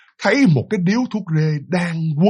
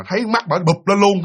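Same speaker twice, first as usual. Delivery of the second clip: usual speaking voice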